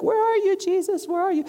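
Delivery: high calling, a hammed-up seeker hunting for Jesus; mock frantic searching. Pastor mocks believers hunting for Jesus each morning, high calling. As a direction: call out high like a lost child in a game of hide and seek. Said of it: "wake up and be like".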